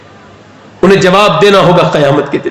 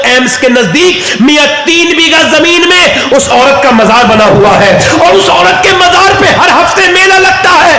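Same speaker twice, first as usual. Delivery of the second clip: preaching at full force; 11 semitones higher than usual. Acted. Preacher to the gathering, crying out at full force. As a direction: pour it out to the crowd as a rising wail. Preacher's climax about the shrine.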